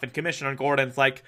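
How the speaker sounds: ordinary talk, nothing marked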